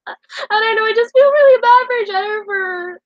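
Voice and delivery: words pushed out through real tears; real sobbing regret; crying sigh then sob-speech. Real, not acted. Crying, voice breaking.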